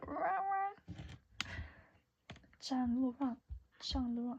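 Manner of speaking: put-on high playful Chinese accent. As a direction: mimic a Chinese drama heroine. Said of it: "How's my Chinese accent?".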